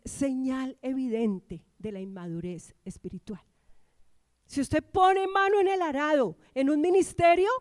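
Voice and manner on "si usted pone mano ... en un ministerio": raised, louder pulpit voice; emphatic preaching. Real, not acted. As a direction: press the warning on the congregation. Predica con fuerza. (predicación enérgica, con voz alzada)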